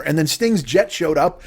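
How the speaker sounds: usual speaking voice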